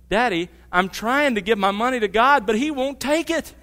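a lightly voiced exasperated little girl; exasperated child. Exasperated little daughter quoted.

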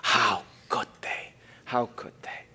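slow, breaking into a whisper; anguished whisper. Acted. Preacher voicing the grieving psalmist, hushed anguish. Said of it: "the psalmist is saying".

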